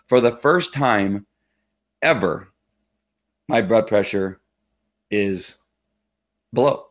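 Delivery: FIRST TIME slow, then long pauses before EVER and BELOW; dramatic pauses. Real, not acted. Telling viewers of a first good reading, dramatic pauses. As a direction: announce it piece by piece, pausing before "ever", "is" and "below".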